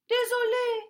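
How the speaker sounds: very high, loud quick sorry; startled apology. A narrator's reading. Bear apologising to the owl, very high and loud. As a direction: blurt it out.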